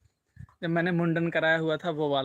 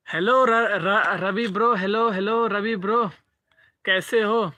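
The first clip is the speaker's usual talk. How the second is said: excited greeting; 3 semitones higher, 5 dB louder, slower than usual. Real, not acted. Greeting viewer Ravi in the chat, loud and eager. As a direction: call out happily to Ravi.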